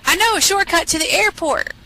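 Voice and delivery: high cartoon fox voice; luring fox. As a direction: coax them along in a high voice. Fox lures the birds along.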